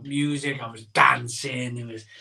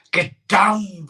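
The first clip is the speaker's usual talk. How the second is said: louder, pushing the words out; mock struggle shout; 5 semitones higher, 6 dB louder than usual. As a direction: strain as if forcing food down. Forcing bread roll down.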